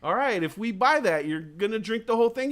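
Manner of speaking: mock parent asking if the kid will really finish it. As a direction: quiz the kid like a skeptical parent. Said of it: "and then there's the adult like".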